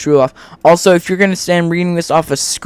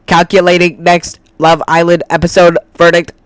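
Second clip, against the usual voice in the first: mock robot voice; 4 dB louder than usual. Mocking people without free will in a light robot voice. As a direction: play a brain-dead robot reciting its likes. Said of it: "I am a robot".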